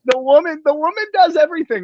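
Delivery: high, bright emphatic praise; excited high praise. Real, not acted. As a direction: rave about her, voice way up. Praising his wife.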